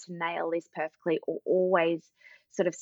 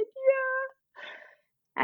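put-on much higher, tearful drawn-out child yeah; crying toddler. Mum voices her son finally giving in, tearful child voice. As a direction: let the little one dissolve into tears.

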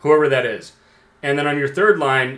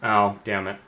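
ordinary delivery

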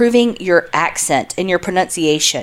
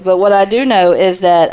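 ordinary talk

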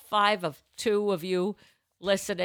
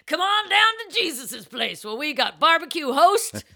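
hammed-up restaurant commercial host; cheesy ad voice; 6 semitones higher than usual. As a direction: sell it like a local TV ad. Mock restaurant ad as Jesus.

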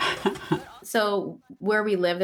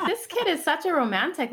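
amused, laughing; 9 semitones higher than usual. Retold or imitated. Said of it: "I'm just like".

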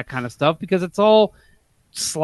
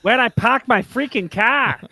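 mock New England accent; 6 semitones higher than usual. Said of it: "work on our New England accents".

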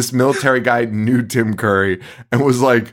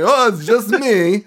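higher, slow, cartoonish Romanian accent; hammed-up mock Romanian accent, pitched up and stretched; cartoonish Borat-style accent; 7 semitones higher, slower than usual. Mocking Tim Curry's introduction, cartoonish Romanian accent. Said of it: "almost Borat style fucking accent".

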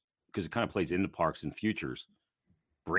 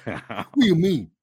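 mock pissed off; 9 semitones higher, 9 dB louder than usual. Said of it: "He was so pissed".

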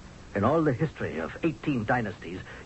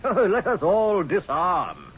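slow, higher, louder mock of a sugary king; 6 semitones higher, 4 dB louder, slower than usual. General mocks the Hittite king to the queen, slow and sing-song. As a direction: lampoon him with a laugh under it. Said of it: "King Hassi told me".

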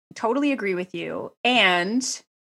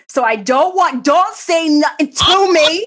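louder, slower, insistent protest; emphatic retold outburst; 6 semitones higher, 4 dB louder, slower than usual. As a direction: defend the slippers with passion. Retold telling husband off.

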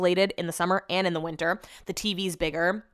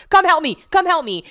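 louder, higher calls for help; panicked calling; 8 semitones higher, 9 dB louder than usual. Retold or imitated. Stuck on the bike, calling her husband to help. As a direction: call out for rescue in a panic. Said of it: "I was like".

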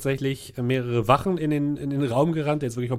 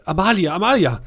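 calling out; 6 semitones higher, 6 dB louder than usual. Acted. Old master finds dead woman.

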